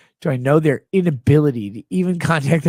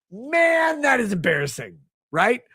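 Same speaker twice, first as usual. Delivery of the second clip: mocking shout; 7 semitones higher than usual. Real, not acted.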